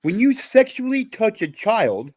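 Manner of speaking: slow, forceful indignation; outraged. Real, not acted. Survivor condemning child abusers to another guest, outraged. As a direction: drive each word home in anger.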